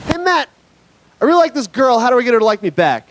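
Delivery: put-on high-pitched eager kid voice; young kid. Kid asks comedian for crush advice, high kid voice. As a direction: ask it high and quick, like a little boy. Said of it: "one kid came up to me".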